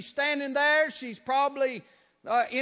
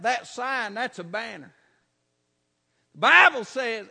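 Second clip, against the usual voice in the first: usual speaking voice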